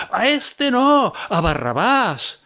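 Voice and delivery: high, slow shouted crowd cry; crowd shouting. Acted. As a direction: yell it out like an angry mob. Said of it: "Se pusieron a gritar otra vez".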